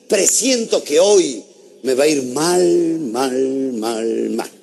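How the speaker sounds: mock-doomed self-prediction; gloomy foreboding. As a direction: drag each word down, fading as you go. Acted gloomy morning self-prediction.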